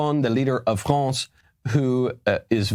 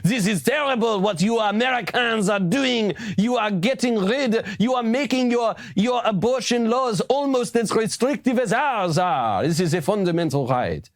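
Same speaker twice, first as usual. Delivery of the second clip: lampoon a smug foreign leader, way up high; mock voice of a preachy president; mocking Macron; 12 semitones higher than usual. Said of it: "Macron weighs in and goes".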